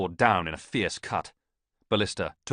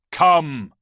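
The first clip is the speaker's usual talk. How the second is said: single booming cry, much higher and louder; thunderous call; 9 semitones higher, 12 dB louder than usual. A narrator's reading. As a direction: call out like thunder over the battle. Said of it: "A voice like thunder echoed".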